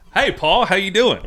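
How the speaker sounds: high, loud, bright hello to a guest; very enthusiastic greeting. Real, not acted. Host greeting guest Paul on camera, very enthusiastic. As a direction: greet him with a big grin. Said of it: "That was very enthusiastic".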